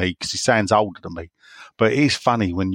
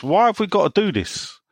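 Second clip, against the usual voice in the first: higher-pitched mock complaint; questioning joke character; 8 semitones higher than usual. Fan in a joke questioning the announcement, higher puzzled voice. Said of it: "And he was asked".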